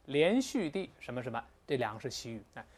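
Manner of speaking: usual speaking voice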